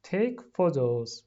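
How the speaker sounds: very slow, clear teacher model; drawn out for learners to copy; slow model phrase. Shown as a demonstration. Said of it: "slow model word for class".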